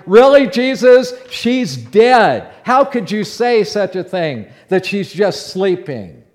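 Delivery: loud, high-pitched incredulous challenge; scoffing disbelief. Acted. Mourners scoffing at Jesus over the dead girl, loud disbelief. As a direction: burst out in mock disbelief, then ease off slightly. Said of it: "they just started out laughing, like".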